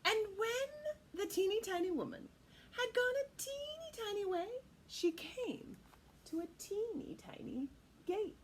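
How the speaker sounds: lilting read-aloud; storybook narration. A narrator's reading. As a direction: read it like a picture book.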